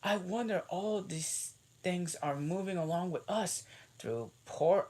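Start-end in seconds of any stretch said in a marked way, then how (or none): 0.0-3.6 Alice voice. A narrator's reading. Alice wonders aloud.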